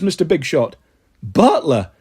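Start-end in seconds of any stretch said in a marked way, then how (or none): none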